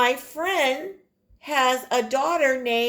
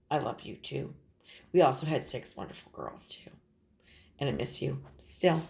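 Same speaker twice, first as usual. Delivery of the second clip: tender; 8 semitones lower, 8 dB quieter, faster than usual. Real, not acted. Tender words to former class.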